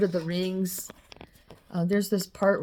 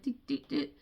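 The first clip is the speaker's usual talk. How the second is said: playful teasing noise; 6 semitones higher, 8 dB quieter than usual. Retold or imitated. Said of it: "they're like".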